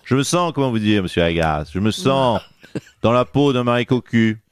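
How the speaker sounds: low, put-on politician voice, hammed-up heartbreak; low mock-wounded lament; mock jilted politician. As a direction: play the betrayed boss in a low caricature voice.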